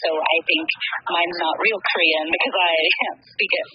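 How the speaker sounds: normal talking voice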